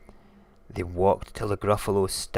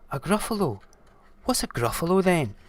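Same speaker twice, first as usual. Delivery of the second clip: high, quick questioning; high-pitched puzzled creature; puzzled snake; 9 semitones higher, 4 dB louder, faster than usual. Acted. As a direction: ask in surprise what on earth that is.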